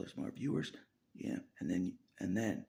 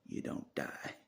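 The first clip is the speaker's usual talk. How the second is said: ordinary talk, nothing marked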